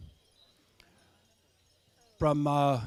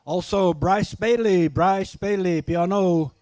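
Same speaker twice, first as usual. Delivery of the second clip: powwow emcee; 3 semitones higher, 7 dB louder, slower than usual. Acted. Emcee calls singer's name.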